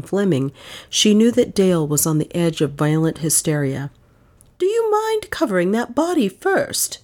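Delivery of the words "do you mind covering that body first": high, crisp request. A narrator's reading. Aunt interrupting the detective for her niece's sake, crisp. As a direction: ask it crisply, pitched well up. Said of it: "she asked crisply".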